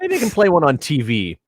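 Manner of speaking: put-on high, loud, eager kid voice; mock childish excitement. A grown man playing his eager seven-year-old self, loud and high.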